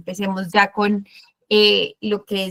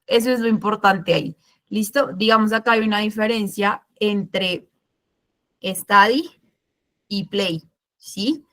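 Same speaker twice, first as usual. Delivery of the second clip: normal talking voice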